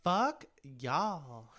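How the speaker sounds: lightly twanged, kindly Southern put-down; sweetly caring Southern insult. Retold or imitated. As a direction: say the insult as if it were affectionate. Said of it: "the nicest, most caring way possible".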